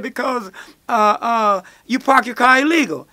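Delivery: officer justifying arrest, mock stern; cop voice. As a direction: lay down the law.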